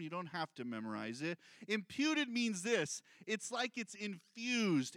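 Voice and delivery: ordinary talk, nothing marked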